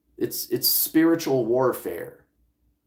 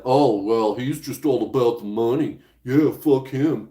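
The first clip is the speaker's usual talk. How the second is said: a hint of a sneering critic, lightly put on; a light jab at the scornful viewers; mocking critics. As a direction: lightly imitate a resentful viewer scoffing. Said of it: "be like".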